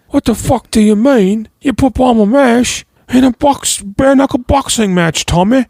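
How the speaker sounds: high, loud angry Cockney rant; impression of a furious gangster yelling at Tommy; Statham. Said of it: "It's my Statham".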